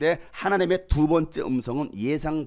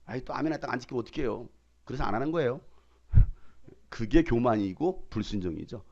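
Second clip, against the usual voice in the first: ordinary delivery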